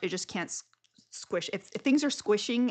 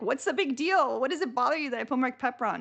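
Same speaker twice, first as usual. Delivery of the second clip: mock protest; 6 semitones higher, 5 dB louder than usual. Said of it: "I'm like".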